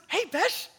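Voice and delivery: high bright mock greeting; a light imitation of a cheerful student. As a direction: imitate his sunny hello. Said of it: "he goes".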